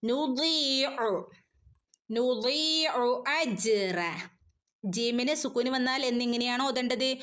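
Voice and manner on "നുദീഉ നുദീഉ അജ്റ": slow chanted ലാ നുദീഉ; recitation. Shown as a demonstration. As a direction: stretch the ദീ two beats. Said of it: "രണ്ടനക്കം നീട്ടിക്കൊടുക്കുക". (രണ്ടനക്കം നീട്ടി)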